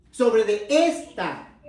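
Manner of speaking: very slow, "esta" drawn out with a falling end; deliberate, emphatic "esta"; stress on "esta". Shown as a demonstration. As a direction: land heavily and slowly on "esta". Contrasts esta with esa.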